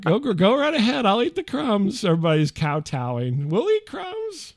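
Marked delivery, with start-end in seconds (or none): 0.0-2.0 high timid giving way; meek husband. Retold or imitated. Husband kowtowing to wife, meek and high. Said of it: "I'm like". 3.5-4.5 high, slow, soft timid offer; meek chorus. Retold or imitated. Family kowtowing to wife, meek and high.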